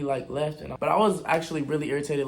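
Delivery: usual speaking voice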